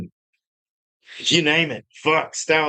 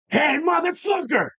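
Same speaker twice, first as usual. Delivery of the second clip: higher, shouted insult; retold shout; 11 semitones higher than usual. Retelling how he shouted at the driver, a higher shout. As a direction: yell it across the street. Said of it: "I yelled over".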